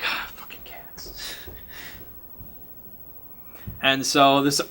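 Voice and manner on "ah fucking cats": slow unvoiced grumble; whispered curse. [name] annoyed by hissing cats, slow whispered curse. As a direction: hiss the curse under breath.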